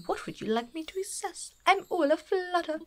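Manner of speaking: a hint of a fluttery posh curator; mildly posh, all a flutter; posh owl curator. Player voicing Blathers asked to assess fossils, lightly posh.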